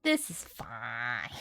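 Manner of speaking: slow, low, deadpan this is fine; deadpan irony. Real, not acted. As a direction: say it like it's clearly not fine. Ironic comment on the game.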